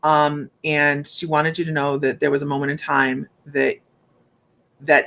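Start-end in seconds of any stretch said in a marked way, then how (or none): none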